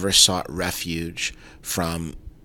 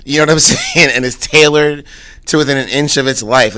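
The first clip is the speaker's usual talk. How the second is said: laughing; 7 semitones higher, 9 dB louder than usual. Real, not acted. Laughing about the expensive coat.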